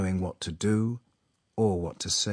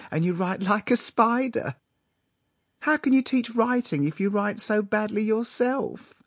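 put-on high-pitched teasing young woman; affected girl; 12 semitones higher, 3 dB louder than usual. A narrator's reading. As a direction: tease the writing master in an affected high voice. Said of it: "giggled the girl with an affected shriek".

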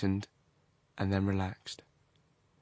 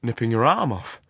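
lightly voiced, louder echo; changed manner; 2 semitones higher, 7 dB louder than usual. A narrator's reading. Horrocks answers the complaint about the arm, manner changed. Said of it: "His manner changed again".

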